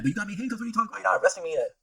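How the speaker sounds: high-voiced, disbelieving argument; retold protest. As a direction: replay objecting to the cops in a high voice. Protesting handcuffs to police in retold story.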